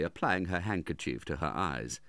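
ordinary delivery

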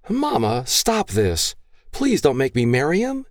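put-on high, pleading young woman's voice; pleading daughter. Daughter begging her gun-waving father, high and pleading. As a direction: beg your father to spare him.

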